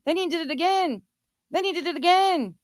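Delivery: high-pitched sarcastic refrain; mock dismay. Real, not acted. Host recounting his repeated slur, high mock dismay. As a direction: repeat it high, making a show of it.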